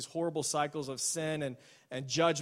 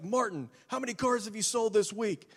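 higher, demanding mock interrogation; furious boss; 6 semitones higher than usual. Boss grilling Martin at the sales board, mock angry. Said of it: "he's irate and he's furious".

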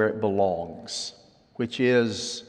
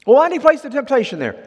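fast, high, loud comeback; protesting objector; 13 semitones higher, 7 dB louder, much faster than usual. Retold or imitated. Believer arguing with the pastor about temptation, protesting. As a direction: fire back the objection quickly, pitched up.

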